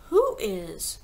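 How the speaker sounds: usual speaking voice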